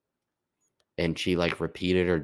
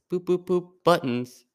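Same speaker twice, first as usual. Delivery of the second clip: high mock boops, then Buttons; 11 semitones higher, 5 dB louder than usual. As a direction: pitch the boops way up, playful mockery. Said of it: "she was like".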